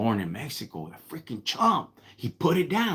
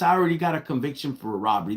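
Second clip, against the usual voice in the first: normal talking voice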